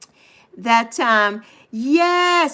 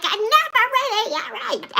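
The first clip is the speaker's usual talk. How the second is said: mock mother; 11 semitones higher than usual. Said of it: "She's like".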